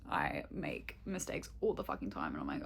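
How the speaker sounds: whisper. Real, not acted. Confessing mistakes making rosters.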